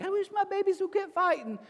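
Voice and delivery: high-pitched mock whiny parent; fretting parent impression. Pastor mocking modern parents about their kids, high fretting voice.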